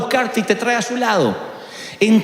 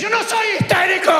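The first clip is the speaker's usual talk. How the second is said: mock hysterical denial; 10 semitones higher than usual. Quoting a hysterical counselee.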